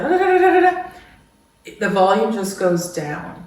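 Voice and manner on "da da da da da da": high loud mock chatter of racing thoughts. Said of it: "all those thoughts, they're like".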